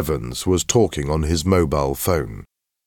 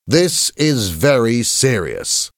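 slow, higher, weighty announcement; stern headmaster; 6 semitones higher, 3 dB louder, slower than usual. A narrator's reading. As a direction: address the hall slowly and seriously. Headmaster warns the assembly.

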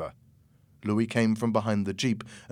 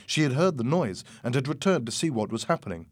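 plain reading voice

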